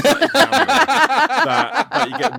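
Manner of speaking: high, loud, through real laughter; laughing. Real, not acted. Teasing David as the dreaded examiner, laughing. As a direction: crack up while trying to get the words out.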